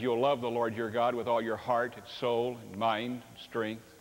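normal talking voice

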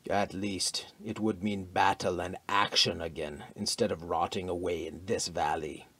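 muttered. A narrator's reading. Tall Aesir captain grumbling about the idle valley, a low mutter. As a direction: mutter it under your breath, bored of peace. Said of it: "muttered the tall Aesir captain".

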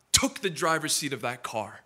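ordinary talk

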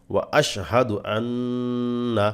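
আন্না with the ন্ন held nasal; nasal ghunnah. Shown as a demonstration. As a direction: hum through the ন্ন in আন্না. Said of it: "নুনে ওয়াজিব গুণা করবেন". (আন্না-তে গুন্নাহ করে পড়া)

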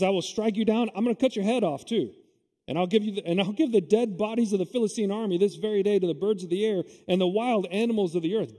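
bold, declaiming Bible reading; preacher reading David's challenge with force; scripture reading. Acted. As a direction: proclaim David's defiance to the giant. Reads the verse on from the Bible.

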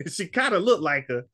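put-on high, excited old man retelling; imitating the old man. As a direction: pitch up high like an eager old caller. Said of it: "He said".

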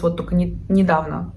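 ordinary delivery

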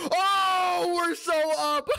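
high, loud victory yell; overjoyed cry of triumph; thrilled shout. Real, not acted. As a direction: shout the win out in real joy. Winning a coin flip.

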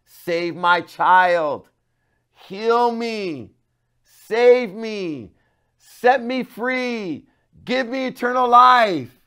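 loud, high, very slow pleading cry; drawn-out desperate shout to Jesus. Shown as a demonstration. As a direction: pray out loud like you mean it. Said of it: "to cry out and say".

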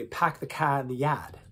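R-dropping Boston accent. Shown as a demonstration. Accent warm-up where we say, Boston accent. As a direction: drop every R like a Bostonian. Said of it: "how about Boston".

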